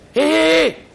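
high, loud hail across the sea; acted distant-rescue holler; calling out. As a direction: hail a far-off stranger at full pitch. Castaway calls across the sea.

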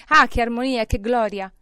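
loud, high cry of delight; elated outburst; exultant. A narrator's reading. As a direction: exclaim in rapture at the sound. Scrooge exults at the bells.

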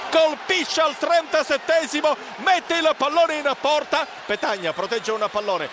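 ordinary talk

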